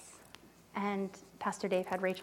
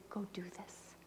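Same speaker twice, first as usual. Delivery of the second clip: hushed command; 16 dB quieter, slower than usual. Retold or imitated. Voicing God's quiet instruction.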